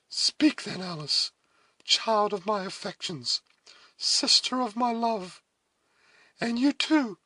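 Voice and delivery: high, earnest appeal in short phrases; pleading heroine. A narrator's reading. Cora begging Alice and Duncan for counsel, pleading.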